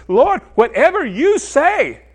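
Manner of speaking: high, loud, drawn-out cry of submission; emphatic surrender. Acted. Preacher voicing a mind bowing to the Lord, emphatic surrender. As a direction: slowly proclaim it at a high pitch.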